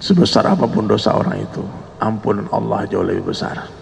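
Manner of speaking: low, measured, reassuring counsel; gentle wise scholar. Retold or imitated. Wise scholar counselling a repentant killer, calm and gentle. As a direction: calmly offer mercy, then patiently give the conditions. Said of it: "Ulama yang hati penuh dengan zikir".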